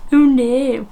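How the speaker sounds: high, exaggerated Aussie vowel; Australian accent. Vlogger mocking her Belfast slip, broad Australian. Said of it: "a bit Australian".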